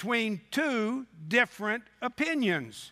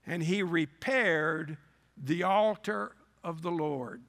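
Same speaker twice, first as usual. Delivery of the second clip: normal talking voice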